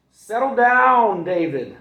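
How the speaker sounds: very slow, very loud high shout; exasperated mother's shout; mom shouting. Acted. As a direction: stretch out settle down. Mom scolding angry David.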